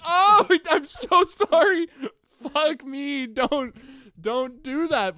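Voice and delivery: high laughing squeal of sorry; a loud laughing oh no, then sheepish; laughing apology. Retold or imitated. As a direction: yelp an apology through a laugh. Apologizing to squeezed girlfriend.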